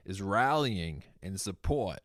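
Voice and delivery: usual speaking voice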